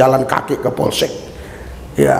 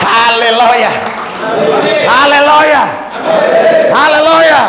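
high, loud hallelujah shout to the crowd; rousing revival-preacher cry; rallying shout; 12 semitones higher, 5 dB louder, slower than usual. Real, not acted. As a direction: call out to the congregation, climbing higher and louder. Preacher rallies congregation after testimony.

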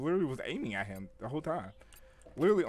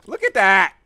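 loud; 7 semitones higher, 16 dB louder, slower than usual. Real, not acted. Gamer shows his teammate a clip.

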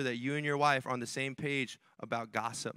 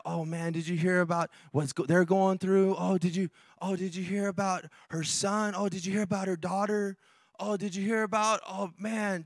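gossiping churchgoer; 4 semitones higher than usual. Acted. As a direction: imitate someone eagerly passing on juicy news. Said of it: "Gossiping about, you know".

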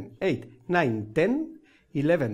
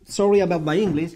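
normal talking voice

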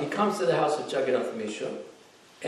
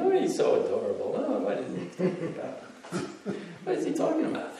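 laughing mothers; 6 semitones higher, 4 dB quieter than usual. Retold or imitated. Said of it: "And they're all laughing".

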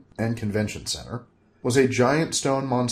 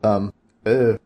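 plain reading voice

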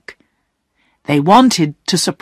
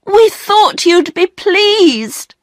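much higher, slow pleading; Bobby pleads, pitched up; cried out; 12 semitones higher, slower than usual. Acted. Bobby pleads with the angry Perks, higher voice. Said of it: "she cried".

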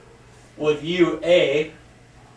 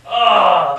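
embarrassed groan; 4 semitones higher, 9 dB louder than usual. Real, not acted. Embarrassed by own story.

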